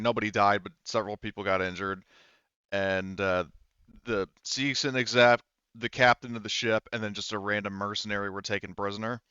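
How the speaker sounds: ordinary talk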